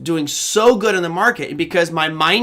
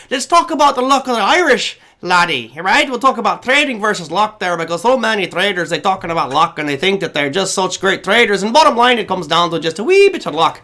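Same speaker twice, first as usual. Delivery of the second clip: playful mock Irish brogue; Irish accent; 3 semitones higher, 3 dB louder than usual. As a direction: put on a lilting Irish accent for fun. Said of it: "I won't do my Irish accent anymore".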